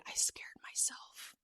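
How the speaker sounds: slow, barely-there whisper; very soft. Retold or imitated. Runner confiding in her friend after panic, very soft whisper. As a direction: whisper it as if the voice is gone. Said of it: "very softly, I say".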